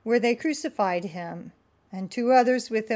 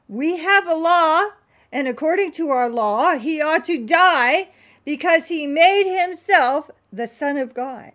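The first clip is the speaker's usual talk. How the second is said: louder, higher, pressing claim; insistent crowd; 6 semitones higher, 7 dB louder than usual. Acted. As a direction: insist on the law, raised.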